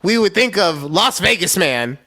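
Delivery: usual speaking voice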